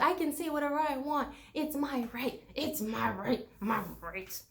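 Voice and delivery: fading whiny "my rights" repeated; mocking an entitled tourist trailing off; hammed-up entitled whine that fades. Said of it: "They're like".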